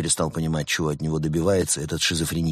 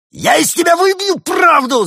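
screamed much higher and louder; roaring; 9 dB louder than usual. A narrator's reading. Bad cop lieutenant roars at the penguin. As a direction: explode into a roar. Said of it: "заорал лейтенант".

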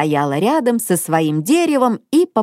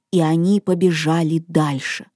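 ordinary delivery

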